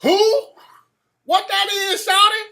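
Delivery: very high, loud mock Atlanta local; hammed-up high-pitched confusion; imitated puzzled local. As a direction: squeal it like a baffled stranger. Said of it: "They're like".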